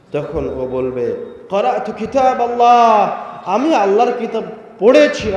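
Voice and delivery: loud, high fervent preaching; preaching at full force. Acted. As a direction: thunder the question to the crowd. Angel's question preached loudly.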